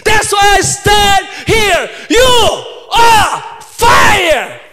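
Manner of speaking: loud, high, slow preaching shout; preaching at full force. Acted. A preacher telling his old teacher off, shouting slowly. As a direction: hammer each line out to the crowd.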